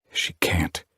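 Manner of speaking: whispered thought. A narrator's reading. Inner thought.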